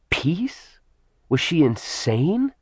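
unvoiced, incredulous whisper; whispered disbelief. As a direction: breathe it in disbelief. Incredulous inner thought.

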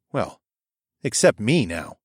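plain reading voice

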